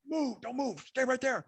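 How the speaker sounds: high, urgent cop's command; retold shout. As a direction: imitate a cop shouting orders. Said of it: "and just shouts".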